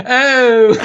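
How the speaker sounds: high, loud shocked "Oh!"; a prim lady's startled cry; Lovey recoils in disgust; shocked gasp. Retold or imitated. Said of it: "I could hear Lovey going".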